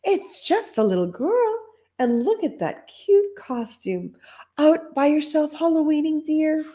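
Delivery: high, cooing lady's voice; sweet old lady. Acted. Lady cooing over the costumed girl at the door. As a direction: coo at the little girl. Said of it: "said a lady".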